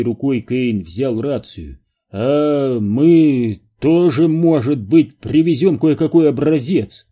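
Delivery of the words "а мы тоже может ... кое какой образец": hesitant reply with a long drawn-out start; stammering. A narrator's reading. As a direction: falter into it, raised and unsure. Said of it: "запинаясь, проговорил Кэйн". (запинаясь, неуверенно)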